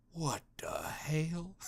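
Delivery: whispered, slow shock; mock whisper. [name] whispering in shock at his accent.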